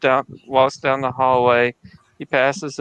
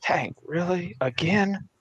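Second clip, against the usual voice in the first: disappointed husband; 5 semitones higher, 6 dB quieter, slower than usual. Retold or imitated. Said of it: "he's like".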